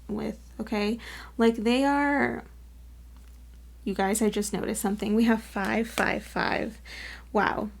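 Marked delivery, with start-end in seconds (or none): none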